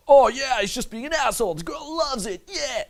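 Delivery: high, dopey parody of an excited fan; mock naive viewer. Coach mimicking what people see on YouTube, mock naive voice. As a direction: imitate a clueless viewer far up in pitch.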